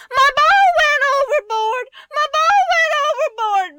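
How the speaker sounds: put-on high, loud child's wail; Benny crying out. A narrator's reading. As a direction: wail it like an upset little boy. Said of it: "Benny cried".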